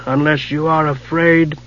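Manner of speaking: slow, soft, ominous wizard; soft rasping wizard. Acted. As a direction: murmur it slowly, full of menace. Raistlin's voice.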